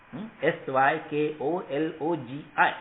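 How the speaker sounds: normal talking voice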